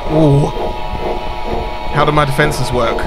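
spooked, blurting it out; scared and loud. Real, not acted. Monster comes close, startled.

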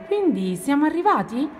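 hopeful question. Acted. Ranocchio checks with his friend at the summit, hopeful. Frog hopes they have arrived.

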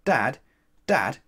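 put-on loud, high child's call; high, loud, quick child's request. A narrator's reading. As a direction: call downstairs to dad like a cheeky child. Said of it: "one of the children called out".

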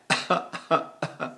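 soft, spaced "ha, ha, ha"; demonstrated laugh. Shown as a demonstration. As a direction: laugh lightly in separate pulses.

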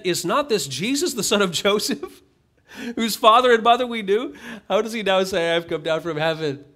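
high, loud scoffing townsfolk; mocking. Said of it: "There's a mocking tone".

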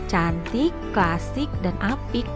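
ordinary talk, nothing marked

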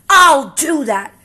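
high, loud, slow screech. Acted. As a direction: spit it out in a huff. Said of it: "screeched the grouchy ladybug".

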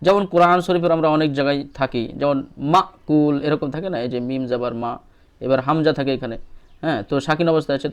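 ordinary delivery

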